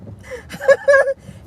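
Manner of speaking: a high shriek of laughter; high laughing shriek. Real, not acted. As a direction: burst out in a high cackle. Laughing at herself.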